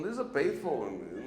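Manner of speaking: ordinary delivery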